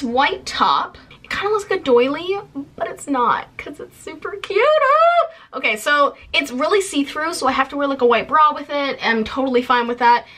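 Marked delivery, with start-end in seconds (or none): 4.6-5.6 sudden high squeal of delight; squeal, caught off guard; excited squeal. Real, not acted. Reacting to the see-through top.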